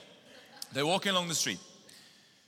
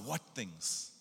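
a quiet, knowing smirk; smirking, soft; 4 semitones lower, 8 dB quieter than usual. Pretend. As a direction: ask it with a little smirk, much softer. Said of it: "a little smirk in his eye".

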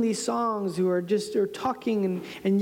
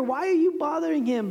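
ordinary talk

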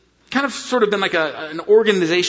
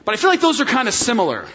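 ordinary delivery

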